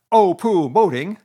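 loud scoffing pooh; great disgust. A narrator's reading. Toad scorning Rat's boating, loud disgust. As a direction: blow it off with contempt. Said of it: "in great disgust".

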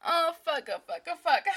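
loud, high cries of pleasure; passionate outcry. Acted. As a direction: let it spill out loud and urgent. Passionate climax.

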